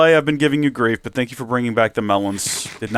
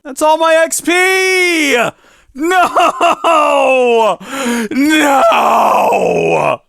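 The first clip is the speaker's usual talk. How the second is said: high, loud, drawn-out wail; anguished yell; 13 semitones higher, 5 dB louder, much slower than usual. Real, not acted. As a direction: cry out at the loss. Loses all XP.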